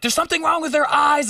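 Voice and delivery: high-pitched retold panic; freaked out. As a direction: imitate someone freaking out. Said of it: "freaked out and was like".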